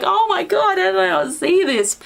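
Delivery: high-pitched mock astonishment; exasperated disbelief. Reviewer reenacting her reaction to the plot, mock astonishment.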